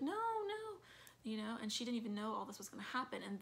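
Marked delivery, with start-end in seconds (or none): 0.0-0.8 much higher, frantic no; a touch of retold panic; panicked denial. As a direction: Marta denying the family's accusations in a panic. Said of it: "And she's like".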